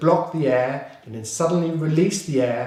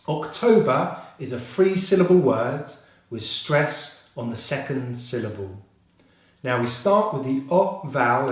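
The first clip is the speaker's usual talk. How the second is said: ordinary talk, nothing marked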